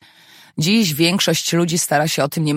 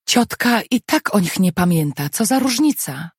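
plain reading voice

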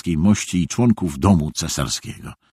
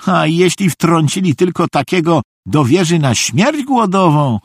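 high, animated naive voice; chatty high-pitched fool; good-natured storytelling simpleton; 8 semitones higher than usual. A narrator's reading. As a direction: ramble happily in a high voice. Narrator voices Szwejk lecturing cellmates.

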